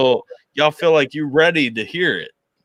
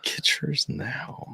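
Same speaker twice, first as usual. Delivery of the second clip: very soft, slow whispered echo; mock ad whisper; 3 semitones higher, 10 dB quieter, much slower than usual. Host echoing the guest's pretend advert, slow soft whisper. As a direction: whisper the tagline back, drawn out.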